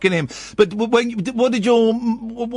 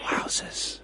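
whispered; 14 dB quieter than usual. Real, not acted. Stunned at the age gap.

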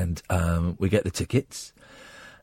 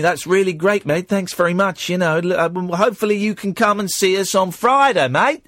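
higher, louder put-on Australian voice; attempted Australian accent; 8 semitones higher, 4 dB louder than usual. Magician humouring an odd fan, mock Australian accent. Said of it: "Where's he from?".

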